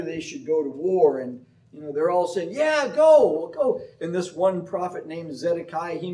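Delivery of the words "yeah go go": higher, louder cheering urge; eager prophets. Retold or imitated. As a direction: egg the kings on to war. Prophets urging war.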